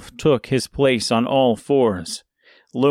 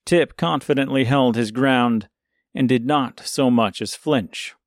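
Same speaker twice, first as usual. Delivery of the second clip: usual speaking voice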